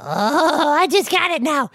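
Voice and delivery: ordinary delivery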